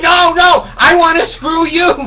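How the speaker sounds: high, loud shout back; mock shout. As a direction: yell it back at him, hammed-up. Shouting back at his penis.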